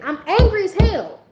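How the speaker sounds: loud, high burst of anger; angry outburst. Retold or imitated. Venting to viewers, bursting out angry.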